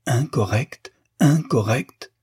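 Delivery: In-cor-rect hammered out syllable by syllable, rising high on the last; hammering the syllables. A narrator's reading. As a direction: pound each syllable of incorrect, angrier each time. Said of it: "en martelant les syllabes".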